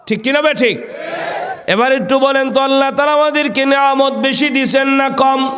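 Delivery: high, ringing call to the congregation; rally the crowd with a high sermon chant; preacher's intoned high list; rousing preaching. Acted.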